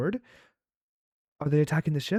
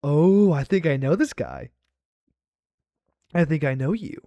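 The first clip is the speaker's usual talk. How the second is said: excited recognition; 2 semitones higher, 6 dB louder than usual. Real, not acted.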